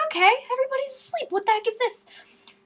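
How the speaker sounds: high, rushed, puzzled panic; acting out a rising fright; mock alarm. Storyteller hearing footsteps in the hallway, alarmed.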